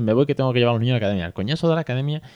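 rushed and fed up, fast; hurried, annoyed friend. Retold or imitated. Acquaintance leaving lunch in a rush, complaining fast about the academy. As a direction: rattle it off like you're late. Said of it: "iba como con prisa".

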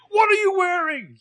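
very high, loud shriek of disbelief; mock outrage. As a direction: squeal it in pretend horror. Imagined Ezra exclaiming at kimono.

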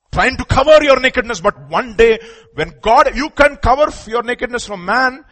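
high, loud pulpit warning; forceful preaching. Real, not acted. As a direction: drive the warning home to the congregation.

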